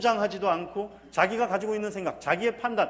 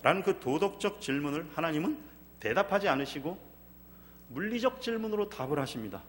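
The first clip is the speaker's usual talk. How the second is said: usual speaking voice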